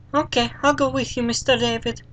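put-on a small boy agreeing happily; eager little boy. Fluffy agrees to go with Mister David, kid voice.

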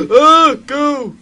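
high, loud panicked yelp; startled yell. Real, not acted. Player urging a game character away, startled yell. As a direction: jump and yell in fright. Jump scare in horror game.

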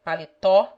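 "paletó" with a wide open final o; open, stressed "aw" closing "paletó". Shown as a demonstration. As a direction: end "paletó" loudly on an open "aw". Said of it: "open and close sounds".

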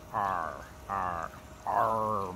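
R with the tongue down and back; a hint of a pirate R; English R. Shown as a demonstration. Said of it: "the tongue is down and back".

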